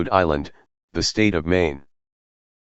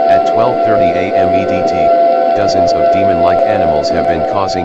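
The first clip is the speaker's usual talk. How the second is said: booming emergency announcement over music, much louder; loud alert broadcast; 9 dB louder than usual. Acted. Alert system warning citizens of demon attacks, loud broadcast voice. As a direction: blare the warning like a siren broadcast.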